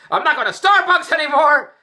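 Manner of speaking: high, loud mock yell; hammed-up furious outburst; retold hollering. Wife shouting at him about Starbucks, loud mock yell. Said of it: "Andrew's hollering at me".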